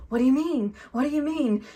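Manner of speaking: a hammed-up tearful celebrity protest; Jennifer Lawrence imitated. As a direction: imitate a star gasping over hot wings. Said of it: "She's literally crying".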